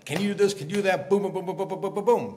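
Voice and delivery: rapid-fire acted berating; volley of questions tumbling into booms; laying into someone. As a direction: pile on the challenges without a breath. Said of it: "he goes back a second time".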